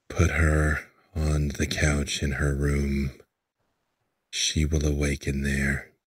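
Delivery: low, grave lord's voice. Acted. Grieving lord asking the dwarf a favour, low and grave.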